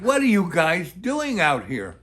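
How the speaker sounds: loud, astonished retold question; incredulous exclamation. Snowplow driver questioning stranded pair, loud and incredulous. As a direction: ask it loudly, with swooping disbelief.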